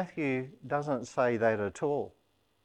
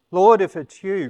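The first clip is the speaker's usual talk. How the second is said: calling out; 6 semitones higher, 12 dB louder than usual. Retold or imitated. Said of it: "Peter replied".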